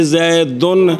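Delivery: زیدن ending in a short "u", zaidun; damma ending. Shown as a demonstration. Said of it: "یہ جو فرق پڑ رہا ہے".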